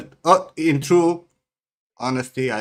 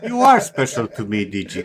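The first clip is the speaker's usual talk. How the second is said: sarcastic; 9 dB louder than usual. Acted. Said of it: "I know that's sarcasm".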